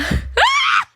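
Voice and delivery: high loud squeal of laughter; piercing giggle; laughing shriek. Real, not acted. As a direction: shriek with embarrassment.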